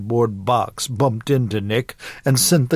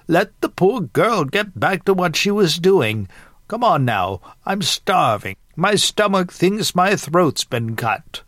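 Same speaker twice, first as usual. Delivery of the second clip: put-on higher, lively Southern old-lady voice; impatient joking grandmother; 8 semitones higher than usual. Starving grandmother hurrying her sister along, impatient and joking.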